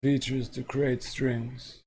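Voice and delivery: measured textbook read-aloud. Acted. Reads the lesson text aloud.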